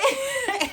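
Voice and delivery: high burst of real laughter. Real, not acted. Laughing while retelling.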